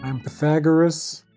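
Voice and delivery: plain reading voice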